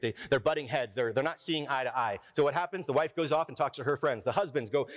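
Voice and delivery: usual speaking voice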